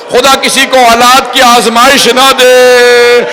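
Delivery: rising into a high, drawn-out wailing cry; impassioned majlis lament, slowing as it climbs; lamenting preacher. Acted. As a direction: build the lament to an anguished peak, stretching line endings. Mourning sermon builds to climax.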